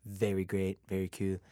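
low, boastful mock president voice; Donald Trump. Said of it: "some dumb shit Trump would say".

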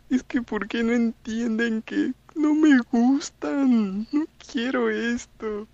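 high, slow, whiny imitation of a crying girl; hammed-up girl near tears; mock crying girl. Mimicking crying girl's complaint.

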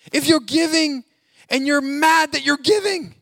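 loud, high preacher's cry; preaching shout. Real, not acted. Pastor shouting at the congregation to stop grudging giving. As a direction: hammer it home from the pulpit.

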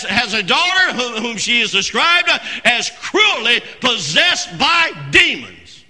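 emphatic, drawn-out key words; full-force preaching. Real, not acted. Preacher on desperation and the possessed daughter, emphatic preaching. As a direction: drive the point home from the pulpit.

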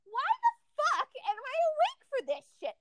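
high-pitched incredulous complaint; retold exasperated protest. Patient protesting being awake for procedure, retold exasperation. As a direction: push the pitch way up in indignation.

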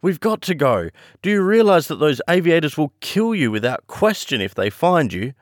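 put-on young man urging her to flee; urgent plea. A narrator's reading. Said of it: "cried John".

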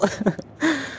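a short high burst of real laughter; high giggle. Real, not acted. Laughing at funny memory.

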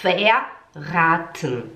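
Verraten very slow in two stretched parts; slow repeat-after-me. Shown as a demonstration. Teacher invites learners to speak along, very slow Verraten. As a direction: draw out Verraten for learners to join. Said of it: "Tenta falar junto comigo".

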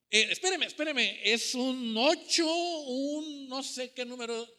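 mock panicked hesitation, slowing right down; flustered stalling. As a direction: stretch the words while pretending to remember. Acted person failing to recall numbers.

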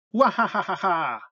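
carcajada exagerada y lenta de científico loco; risa falsa. Acted. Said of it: "la risa falsa de Luca". (carcajada falsa para romper el miedo)

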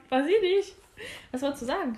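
ordinary delivery

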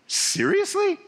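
high, disbelieving "Seriously?"; incredulous. Real, not acted. Preacher reacting to the Israelites' complaint in disbelief. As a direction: throw it out in disbelief.

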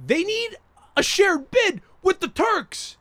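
high, drawn-out indignant shout; slow, high-pitched exasperated cry; exasperated outburst. Real, not acted. Exasperated rant about co-hosting.

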